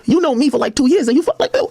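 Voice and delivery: mild mock rant, voice climbing; retold anger. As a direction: vent like you've been betrayed by a friend. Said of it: "I'm mad at her".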